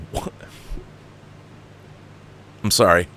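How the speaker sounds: ordinary talk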